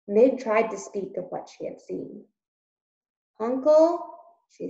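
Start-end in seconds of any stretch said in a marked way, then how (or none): 3.4-4.1 calling. Acted. Meg calls to Uncle.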